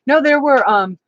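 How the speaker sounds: ordinary talk, nothing marked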